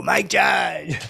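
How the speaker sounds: loud call across the room. Retold or imitated. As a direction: shout his name to get his attention. Calling out across restaurant.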